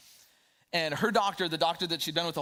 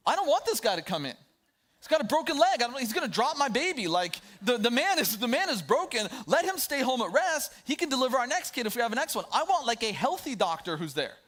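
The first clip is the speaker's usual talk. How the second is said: higher and louder, worked-up protest; agitated retold thought; 7 semitones higher, 4 dB louder than usual. Expectant father in the clinic lobby balking at a doctor with a broken leg, agitated and much higher and louder. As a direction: let the alarm spill out with a half-laugh, climbing as you go.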